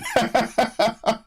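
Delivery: high incredulous burst of laughter; real amused laugh. Real, not acted. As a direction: laugh in disbelief. Laughs at the contract.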